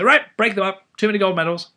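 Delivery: loud, high mocking call to break them up; mock outrage. Lecturer mocking regulators, loud mock outrage. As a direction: ham up a bossy regulator.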